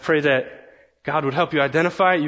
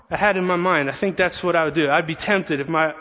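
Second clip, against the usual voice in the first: ordinary talk, nothing marked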